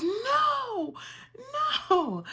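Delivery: loud, very high cry of refusal; alarmed nurse. Retold or imitated. Nurse to patient who needs to poop, loud high-pitched alarm. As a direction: imitate a panicked shout to stop.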